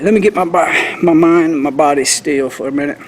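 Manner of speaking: ordinary talk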